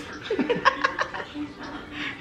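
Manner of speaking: breathless run of giggles; tickled, high-pitched laughter; giggling. Real, not acted. Joking about taking clothes off.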